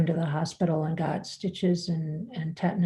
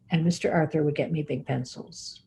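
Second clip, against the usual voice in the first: usual speaking voice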